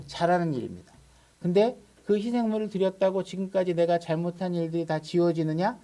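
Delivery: ordinary talk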